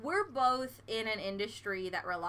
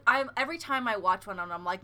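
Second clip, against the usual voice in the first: ordinary delivery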